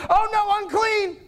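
high, alarmed cry of "unclean"; startled crowd shrinking back, pitched high. Retold or imitated. Crowd recoils from the healed woman, crying out in alarm.

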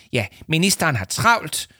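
high-pitched, huffy retort; indignant, scornful huff; snorted. A narrator's reading. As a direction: snap back dismissively. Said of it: "fnøs Leo Manchot".

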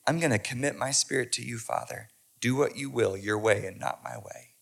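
hushed and low, fading to almost nothing; low hushed prayer; quiet surrender. Acted. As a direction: give the prayer away softly. Preacher voices Jesus praying.

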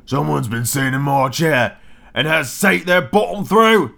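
slow, put-on little bear complaint; wee little bear. Wee bear finding the chair broken, slow little voice. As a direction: drag out the small bear's grievance. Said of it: "in his wee little voice".

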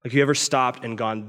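ordinary talk

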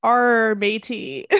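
gruff pirate Arr, matey; hammed-up pirate accent. Shown as a demonstration. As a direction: growl it like a cartoon pirate. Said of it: "here's my pirate accent".

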